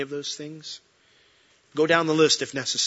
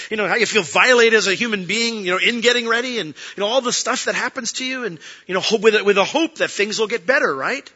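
loud, high, quick riffing; worked-up aside about surgery; animated rant; 8 semitones higher, 7 dB louder than usual. Real, not acted. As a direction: pile it on with rising excitement. Excited riff on surgery.

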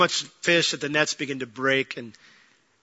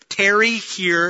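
slow, drawn-out tarry here; stretched-out command; 4 semitones higher, 5 dB louder, much slower than usual. Retold or imitated. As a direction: lean on each word, slowly. Jesus instructs disciples to wait.

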